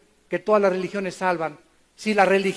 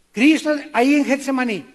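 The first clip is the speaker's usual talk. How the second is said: ordinary talk, nothing marked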